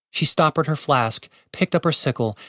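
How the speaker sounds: plain reading voice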